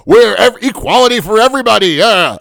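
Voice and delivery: high, loud rallying cry, rattled off; mock socialist speaker. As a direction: parody a confident crowd-rousing slogan shouter. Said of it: "You ever hear a socialist speak?".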